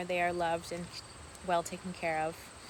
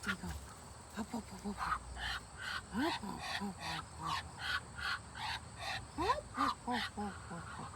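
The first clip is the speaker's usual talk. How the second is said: coaxing duck call; 7 dB quieter than usual. Real, not acted. Calling duck Draco over.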